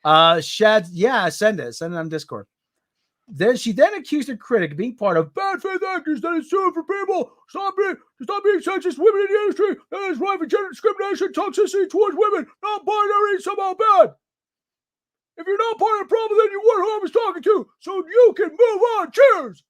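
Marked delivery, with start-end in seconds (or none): 3.3-14.1 mocking paraphrase. 15.4-19.6 much higher, loud dismissive falsetto; high mocking brush-off. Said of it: "She sarcastically waved him away".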